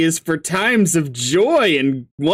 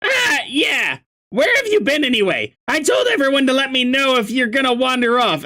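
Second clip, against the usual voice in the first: relieved excitement; 7 semitones higher than usual. Acted. Relieved yelling at missing friend.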